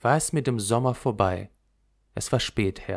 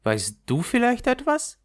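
Gerda asking; 11 semitones higher than usual. A narrator's reading. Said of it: "und fragte".

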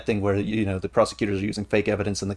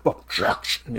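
raspy, breathy demonic objection; 2 semitones lower, much slower than usual. Retold or imitated. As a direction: growl it like a demon shouting in court. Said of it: "it sounds like a demon come out".

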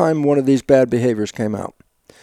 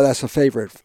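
usual speaking voice

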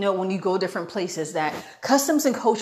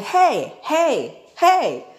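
higher, louder separate heys; calling out; 7 semitones higher, 5 dB louder, much slower than usual. Retold or imitated. Two guys calling to the women ahead, imitated calling out. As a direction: imitate someone calling after you.

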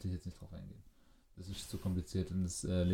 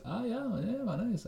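high, light falsetto reply; mock polite reply; 13 semitones higher, slower than usual. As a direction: chirp it back way up high. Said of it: "Du hörst es so und sagst so". (hoch gepiepste höfliche Antwort)

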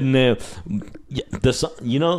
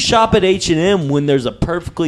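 hammed-up beer ad pitch; mock ad read; 5 semitones higher than usual. Hosts jokingly plugging their sponsor, mock ad read. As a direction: sell it like a beer commercial.

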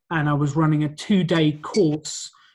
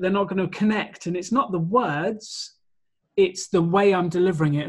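ordinary talk

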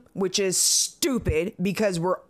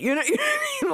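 laughing; 14 semitones higher, much faster than usual. Real, not acted. Laughing at the quote.

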